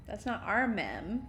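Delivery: ordinary talk, nothing marked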